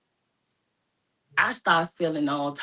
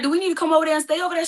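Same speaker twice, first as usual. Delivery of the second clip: urgent rush; 10 semitones higher, 4 dB louder, much faster than usual. Retold or imitated. Worried offer to come over.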